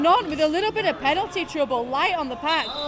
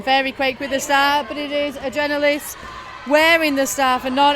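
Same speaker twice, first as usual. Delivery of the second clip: loud, driving live commentary; play-by-play commentary; 8 dB louder than usual. Acted. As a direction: call the action as it happens.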